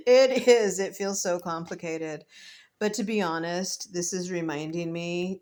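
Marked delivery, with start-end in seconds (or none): 0.1-0.8 said through a laugh, louder. Real, not acted. Vlogger agrees with herself while laughing, louder. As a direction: laugh the words out.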